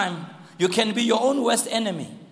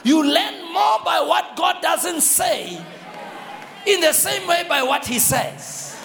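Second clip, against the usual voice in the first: preaching; 7 semitones higher than usual. Acted. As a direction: preach it out with fire. Preaching at full force.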